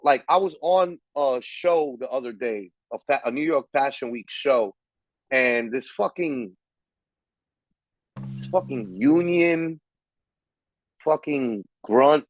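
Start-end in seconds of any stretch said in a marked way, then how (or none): none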